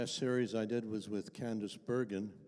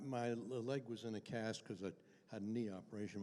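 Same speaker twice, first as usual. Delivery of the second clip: ordinary talk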